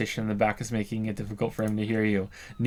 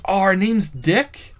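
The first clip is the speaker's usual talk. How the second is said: incredulous; 9 semitones higher, 7 dB louder, slower than usual. Real, not acted. Incredulous reaction.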